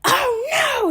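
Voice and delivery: sharp high mock cry of outrage; mocking outraged cry. Uncle mocking her over the slammed door, high mock outrage. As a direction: throw a high, hammed-up "oh no". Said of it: "So he goes".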